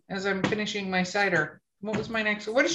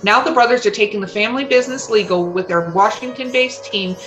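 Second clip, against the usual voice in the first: punchy, louder sponsor read; 5 dB louder than usual. Acted. Host reads the sponsor ad for listeners with punchy energy. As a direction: sell it like a radio spot.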